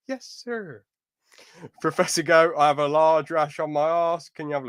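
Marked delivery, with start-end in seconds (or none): 0.1-0.8 mock servile yes sir. Mock voicing Aaron.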